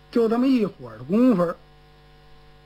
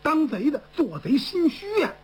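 ordinary delivery, nothing marked

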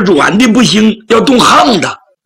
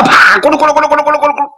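whip crack and rumbling wheels; 10 semitones higher, much faster than usual. Acted. Imitated whip crack and cart wheels.

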